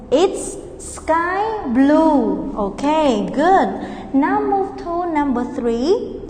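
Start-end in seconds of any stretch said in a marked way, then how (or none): 0.1-2.5 very slow, stretched answer; slow model answer. Shown as a demonstration. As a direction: drag it out for children.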